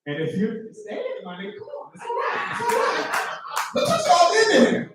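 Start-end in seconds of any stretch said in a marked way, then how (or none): 1.6-4.9 high consoling shout. Real, not acted. Host consoling losing comic.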